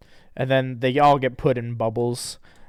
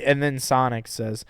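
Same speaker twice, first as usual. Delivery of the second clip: plain reading voice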